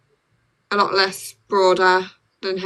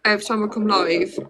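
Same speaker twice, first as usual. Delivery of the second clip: normal talking voice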